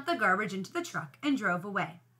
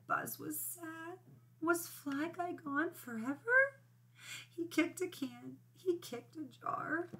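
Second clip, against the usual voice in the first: soft, higher, slow and mournful; sad voice; 5 semitones higher, 9 dB quieter, slower than usual. A narrator's reading. Teacher reads the page where Buzz is crying, sad voice. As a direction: read it small and sorrowful. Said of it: "I need to use a sad voice".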